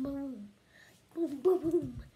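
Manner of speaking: ordinary delivery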